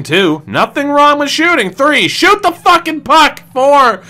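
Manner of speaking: rising frustrated count. Real, not acted. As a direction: pitch jumps high, exasperated. Counting frustrated shot demands.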